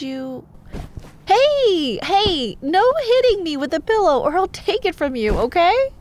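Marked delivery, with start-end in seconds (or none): none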